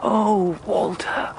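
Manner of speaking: high, rapturous and giddy; gleeful exultation. Acted. Murderer exulting to Walter over their perfect crime, high and rapturous. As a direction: savour the glory of the crime. Villain exults in his plan.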